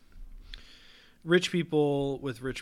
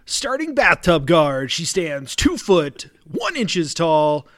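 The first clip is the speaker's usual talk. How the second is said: slow, loud arena announcer; sports announcer; 4 semitones higher, 18 dB louder, much slower than usual. Acted. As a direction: draw out the name like a ring intro. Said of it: "my announcer voice".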